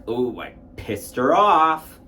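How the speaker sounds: startled oh, drawn out; sudden realization. Retold or imitated. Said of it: "faced my fear in a way by like".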